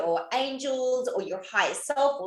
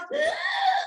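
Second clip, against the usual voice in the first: mock horrified gasp. Dread of rejecting somebody.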